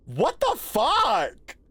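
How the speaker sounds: slowed, incredulous exclamation; baffled drawn-out. Real, not acted. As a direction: drag each word in disbelief. Baffled by game.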